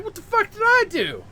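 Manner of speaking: high, loud outraged yell; indignant mock shout; mad protest. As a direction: yell it like you're being wrongly grabbed. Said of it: "Now you're mad".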